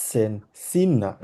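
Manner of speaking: সেন open e, then সিন long ee, slowly; slow contrast. Shown as a demonstration. Teacher slowly correcting vierzehn pronunciation for Bithi. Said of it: "ফিয়েট".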